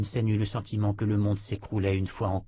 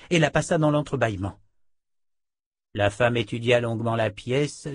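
ordinary delivery, nothing marked

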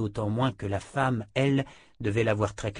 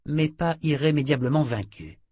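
ordinary delivery

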